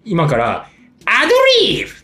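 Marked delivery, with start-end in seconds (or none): 1.1-1.9 high-pitched cue call; announced cue voice. Shown as a demonstration. Host demonstrating the ad-lib cue for listeners, high cue call. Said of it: "っていう声が鳴ったら".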